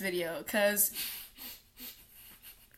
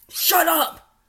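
shouting; 5 semitones higher, 4 dB louder than usual. Real, not acted. Yelled at the mocked person.

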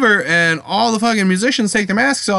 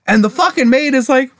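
usual speaking voice

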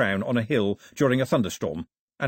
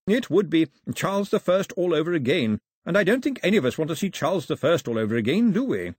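higher, measured official's voice; 7 semitones higher, 4 dB louder than usual. Acted. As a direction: make the case in a raised, emphatic voice.

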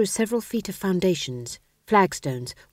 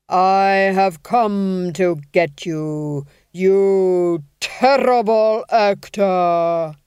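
slow, loud, grave intoning; each syllable falling like a coffin lid; hollow intoning; 8 dB louder, much slower than usual. Acted. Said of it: "he intoned".

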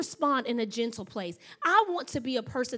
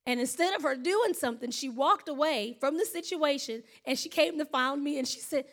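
ordinary delivery

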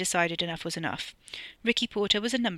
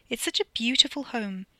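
flat politeness; 5 semitones higher than usual. Acted. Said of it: "a well-practiced politeness delivered flatly".

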